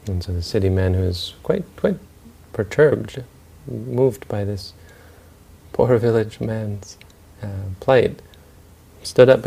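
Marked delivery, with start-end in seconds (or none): none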